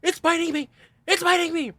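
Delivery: high, full-volume re-enacted shriek; panicked scream. Man screaming as a hornet bites him, panicked. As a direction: scream it twice in escalating comic panic. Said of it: "I'm panicking".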